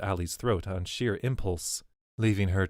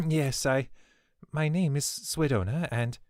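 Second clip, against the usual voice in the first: put-on nervous older woman; 7 semitones higher than usual. A narrator's reading. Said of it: "Swidona cleared her throat".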